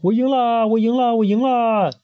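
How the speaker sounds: queen cheers she has won; cheering. Acted. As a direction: crow with childish glee. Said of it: "也跟着欢呼".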